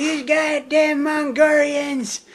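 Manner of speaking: high, drawn-out furious cartoon scream; mock enraged shriek, words stretched out; screaming restaurant owner. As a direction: scream it slow and loud, imitating an outraged cartoon character. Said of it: "he's always screaming".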